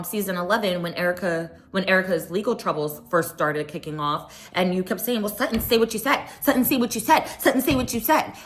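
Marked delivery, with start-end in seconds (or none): 5.2-8.3 higher-pitched, insistent repeated demand; mock badgering. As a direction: imitate someone pestering over and over. Mimicking someone pestering Sutton repeatedly.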